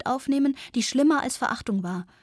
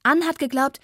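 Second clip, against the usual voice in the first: higher, louder hot protest; indignant raised voice; heated outburst; 5 semitones higher, 6 dB louder than usual. A narrator's reading. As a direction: launch hotly into an accusation. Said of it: "rief ich hitzig".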